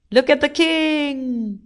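high, slow, stretched-out call; admiring call. A narrator's reading. Crowd watches king fly away, excited. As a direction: call out in wonder, holding the last word.

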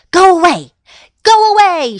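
high, loud shooing cries; shouted order. A narrator's reading. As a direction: shout to chase the fox off. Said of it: "she shouted".

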